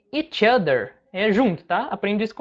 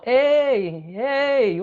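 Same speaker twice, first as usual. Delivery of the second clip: raised, stretched-out call for attention; alerting call; 4 semitones higher than usual. Retold or imitated. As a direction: imitate hailing someone up above. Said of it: "ele alertando, dando um sinal". (chamado de alerta imitado, alongado)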